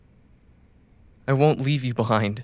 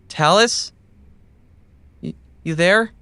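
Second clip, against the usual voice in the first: calling out; 7 semitones higher, 6 dB louder than usual. Acted. Calling out for a missing ally.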